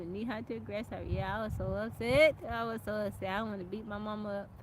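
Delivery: usual speaking voice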